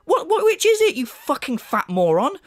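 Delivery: high-pitched, baffled outburst; exasperated. Real, not acted. Ranting at his critic over contradictory demands, exasperated. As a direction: demand an answer, pitch shooting up.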